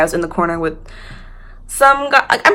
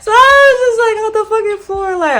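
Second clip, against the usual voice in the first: much higher, squealing laugh through words; 5 dB louder than usual. Real, not acted. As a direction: crack up while telling it. Laughing at her drunk self.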